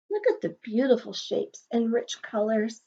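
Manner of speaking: usual speaking voice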